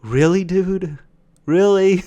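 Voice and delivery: high, loud exasperated really dude; fed-up bystander groaning at the cashier. Retold or imitated. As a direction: disbelief rising into a loud really. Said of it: "exasperated sigh of just like".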